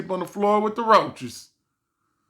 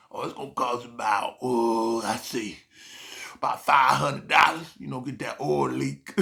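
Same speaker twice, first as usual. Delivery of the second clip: low, quiet, hushed mechanic voice; mechanic quoting price; 4 semitones lower, 4 dB quieter than usual. Retold or imitated. Mechanic quietly breaking the bad news, low and hushed. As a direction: drop low and mumble the price. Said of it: "He'd be like".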